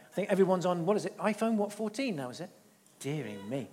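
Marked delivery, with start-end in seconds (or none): 3.0-3.7 softly muttered, low and soft; quiet mock sigh. Real, not acted. Sighing at old technology.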